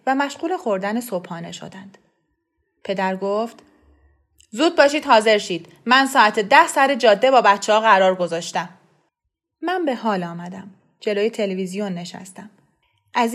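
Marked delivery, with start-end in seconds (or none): 4.5-8.7 louder father hurrying everyone along; urging father. A narrator's reading. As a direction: push them to get ready fast. (پدری عجول و بلندتر)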